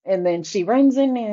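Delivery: ordinary delivery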